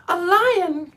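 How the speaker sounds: high, loud squeak of alarm; the queen startled by the word lion; frightened mouse cry. Acted. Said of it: "cried the little queen".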